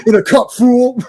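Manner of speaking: a hint of Mister T, quick and gruff; lightly voiced tough guy snapping back; Mister T impression. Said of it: "Mister T's like".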